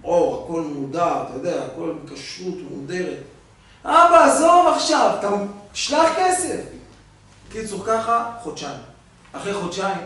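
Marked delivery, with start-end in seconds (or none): 0.0-3.2 lower, much softer fatherly voice; understated father asking his son softly; soft old father. Retold or imitated. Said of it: "אבא אומר לו". 3.8-6.6 high, loud, impatient son; hammed-up son pitched well up and louder. Son cuts father off.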